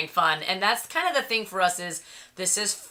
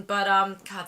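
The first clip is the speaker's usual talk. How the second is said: ordinary talk, nothing marked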